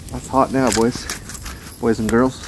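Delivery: ordinary talk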